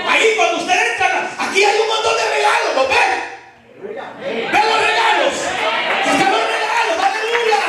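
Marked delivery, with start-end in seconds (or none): 1.4-7.6 fiery preaching. Acted. Preacher urging the congregation to take a miracle, fiery. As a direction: drive it home like a revival preacher.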